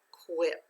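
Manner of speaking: quip read alone as a separate syllable; syllable without accent. Shown as a demonstration. Teacher sounding out equip's second syllable, unaccented quip. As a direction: say quip by itself, short i. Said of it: "without the accent".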